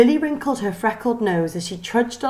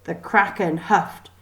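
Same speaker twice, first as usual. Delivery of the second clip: usual speaking voice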